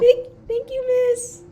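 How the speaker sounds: high-pitched, halting thanks; meek polite pupil. Acted. Student takes a book from the teacher, meek and high.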